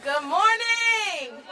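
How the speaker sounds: high, slow, drawn-out good morning; loud greeting. Real, not acted. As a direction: call it out to the crowd. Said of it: "we can do a little bit better than that".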